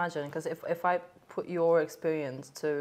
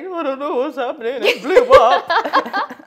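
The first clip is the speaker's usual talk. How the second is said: high, loud mock wail through laughter; crying; 7 semitones higher, 11 dB louder than usual. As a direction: imitate yourself blubbering, half laughing. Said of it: "I'm just crying and crying and crying".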